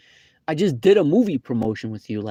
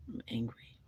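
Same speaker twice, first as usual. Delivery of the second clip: quieter angry; 15 dB quieter than usual. Shown as a demonstration.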